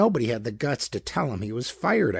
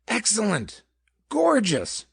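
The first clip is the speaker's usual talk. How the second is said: much higher, gleeful bursts of delight; enthused; 10 semitones higher than usual. A narrator's reading. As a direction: gush like he's just been handed a treat. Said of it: "Mister Peck enthused".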